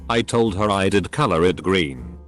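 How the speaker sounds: ordinary delivery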